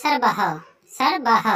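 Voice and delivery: সর্বহ with a full "ho" at the end; final visarga sounded. Shown as a demonstration. Teacher shows word-final visarga, সর্বহ with full final h. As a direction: say "sar-ba-ho", giving সর্বহ its last h. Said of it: "আমরা একে উচ্চারণ করব".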